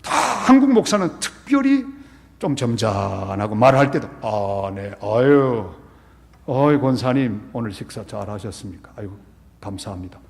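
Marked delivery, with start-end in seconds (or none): 4.2-10.2 a hint of a dignified, soft-spoken pastor; lightly voiced solemn pastor with a polite chuckle; stiff Korean pastor. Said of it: "보통 그러잖아요".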